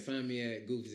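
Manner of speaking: ordinary delivery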